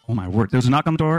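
fast, rattled-off telling; rushed. Shown as a demonstration. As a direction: speed through it to save time. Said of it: "I'm gonna tell the next two stories really fast".

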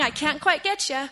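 retold plea, lightly lifted in pitch; coaxing. Daughter reaching for Dad's passing boat, lightly acted coaxing. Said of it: "as I'm coaxing him along".

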